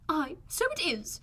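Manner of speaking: high, slow exclamation from the mother; crying out. A narrator's reading. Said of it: "cried her mother".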